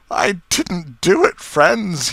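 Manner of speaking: high, loud, slow tearful wail; sobbing. A narrator's reading. Accused man to his friends at the scene, acted sobbing plea. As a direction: plead innocence as if in tears. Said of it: "he sobbed".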